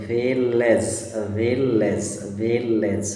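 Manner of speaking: Village in two distinct beats, each time; broken into syllables. Shown as a demonstration. Teacher breaks up a word for a child, syllable by syllable. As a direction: split village in the middle, repeated evenly. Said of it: "এখানে একটু ভেঙে নাও".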